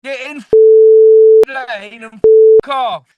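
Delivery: mock road rage. Mock rage against a lane blocker, loud and high. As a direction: scream abuse at a lane blocker.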